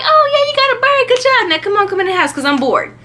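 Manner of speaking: high-pitched, hammed-up congratulations; mock enthusiasm. Girl praising her friend's supposed catch, mock enthusiasm. Acts out own cheering on friend.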